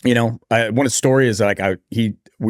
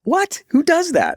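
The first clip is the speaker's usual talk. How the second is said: much higher, squeaky disbelieving voice; mock incredulous bystanders; slower than usual. Mocking people shocked he left the tournament, in a high squeak. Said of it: "They're like".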